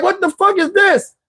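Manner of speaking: very high, disgusted cry; shocked outrage. Retold or imitated. Staring at crabs on his plate, high shocked cry. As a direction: recoil in disbelief.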